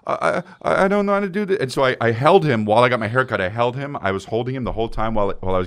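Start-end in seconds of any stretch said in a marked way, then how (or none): 0.1-1.6 put-on higher, halting little-boy voice; upset little kid. Dad imitates his scared son at the barber, high and halting. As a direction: play the scared toddler, stammering. Crying toddler quoted.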